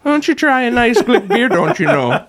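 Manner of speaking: high, lilting Minnesotan offer; mock Minnesota accent, pitch jumping up on the offer. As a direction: sell the beer like a friendly Minnesotan. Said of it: "they do actually talk like that".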